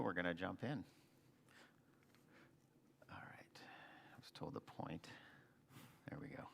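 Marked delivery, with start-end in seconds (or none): 3.1-6.4 very soft, near-whispered mumble; muttering to self. Real, not acted. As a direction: murmur it under your breath, barely voiced. Muttering to self while setting up slides.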